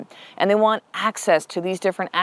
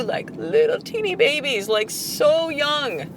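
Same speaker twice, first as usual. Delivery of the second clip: cooing over babies; 9 semitones higher, slower than usual. Real, not acted. Gushing over baby deer.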